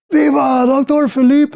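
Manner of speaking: high, ringing chant; the whole village hailing their doctor, high; crowd cheer. Retold or imitated.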